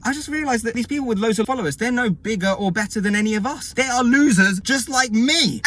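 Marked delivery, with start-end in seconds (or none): 3.7-5.6 mock proud. Real, not acted. Self-mocking punchline.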